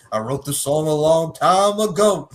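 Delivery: high, slow, loud rap parody. Retold or imitated. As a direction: chant it like a sketch-show rapper. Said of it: "the Chappelle Show with [name]".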